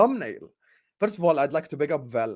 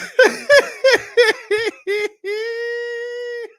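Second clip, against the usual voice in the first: high loud gloating laugh; gleeful cackling laugh; 6 dB louder than usual. Real, not acted. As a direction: cackle at the prank.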